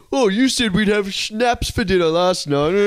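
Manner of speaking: mock a dimwit boyfriend; dumb Neanderthal voice. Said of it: "She's like".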